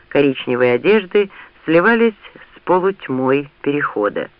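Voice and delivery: ordinary delivery, nothing marked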